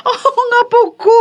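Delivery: overjoyed grandma; delighted cry. Acted. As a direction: gush over the baby. Grandmother first sees her grandchild.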